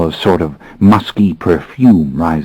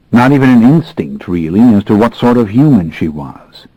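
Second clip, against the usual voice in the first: ordinary delivery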